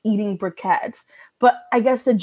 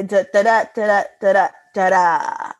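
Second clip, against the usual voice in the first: evenly paired "da-da" beats; rhythmic da-da pacing. Shown as a demonstration. Showing co-hosts the joke's pacing, rhythmic da-das. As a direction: tap out comic timing in syllables. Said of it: "I like the pacing of this".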